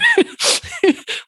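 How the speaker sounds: a real bright high laugh. Real, not acted. Laughs after joking about a divine unicorn, high. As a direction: burst out laughing at her own joke.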